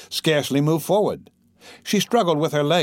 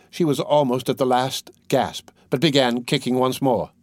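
ordinary delivery, nothing marked

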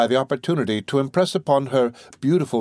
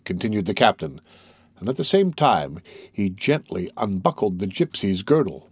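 ordinary delivery

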